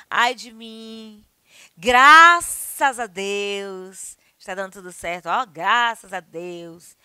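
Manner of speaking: slow loud drawn-out graças a Deus; relieved thanks. Shown as a demonstration. Teacher demos relief, slow and drawn out. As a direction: sigh your thanks slowly. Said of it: "é uma interjeição".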